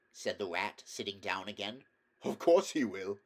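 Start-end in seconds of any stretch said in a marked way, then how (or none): 2.2-3.2 amused, louder laughing reply; chuckling. A narrator's reading. Otter chuckling about Toad rolling in the boat. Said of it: "chuckled the otter".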